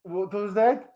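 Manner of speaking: a wobbly put-on Australian accent drifting toward Scottish; shifting mock Australian accent. Shrek chats with the show host in a shaky mock Australian accent. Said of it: "we're getting you in Scottish, sometimes it's Ukrainian".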